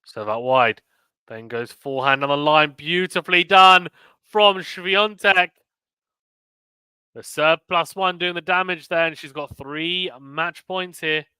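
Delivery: building tennis call, rising in pitch and volume; live play-by-play. Real, not acted. Commentator calling a winner that brings match points, building play-by-play. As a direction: build to the winner, then stretch out the score.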